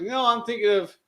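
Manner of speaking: high mock agreeable voice; boss imitation. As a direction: chirp it like a cheery boss. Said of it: "She's like".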